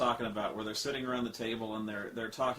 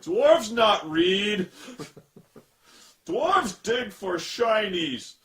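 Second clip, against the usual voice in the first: loud, very slow, higher-pitched simpleton dwarf; dumb dwarf voice; 9 semitones higher, 11 dB louder, much slower than usual. Retold or imitated. Reviewer playing dwarves who only mine treasure, dumb dwarf voice. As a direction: bellow each word slowly like a hammed-up dim dwarf.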